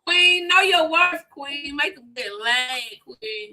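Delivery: mock pep-talk impression, loud then dropping away; T K Kirkland. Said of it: "He talks like this".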